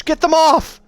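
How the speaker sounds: high, loud panicked shriek; frantic scream. Acted. Kel shrieking at the insects, panicked and loud. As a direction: scream it as if clawing them away.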